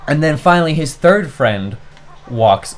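normal talking voice

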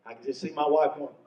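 ordinary talk, nothing marked

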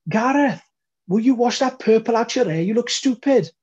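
high, loud shout in thick Welsh; grandmother's thick Welsh yell across the room; thick Welsh accent. Retold or imitated. Grandmother shouting at grandson across the wedding room, thick Welsh accent. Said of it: "had a really thick Welsh accent".

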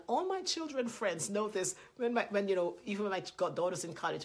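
ordinary delivery

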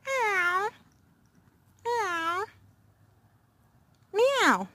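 high imitated meows; meowing at the cat. Owner meowing back at the cat, high and playful.

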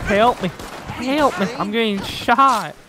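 high, loud calls for help; cries for help. Acted. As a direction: shout for help under fire. Player being shot, calling teammates.

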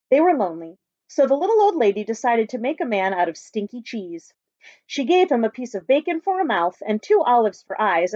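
put-on lively read-aloud for children; story narration. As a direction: tell it like a picture book to a class. Teacher reads picture book aloud.

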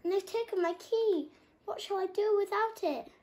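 lion lock frets over his key; worried lion. A narrator's reading. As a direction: plead for help. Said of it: "growled the lion".

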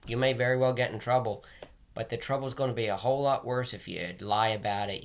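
usual speaking voice

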